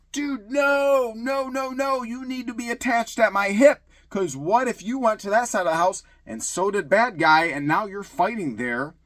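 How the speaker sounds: loud, high, urgent telling-off; acted-out scolding, easing off at the end; exasperated scolding. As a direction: drive home a frantic warning, high and loud.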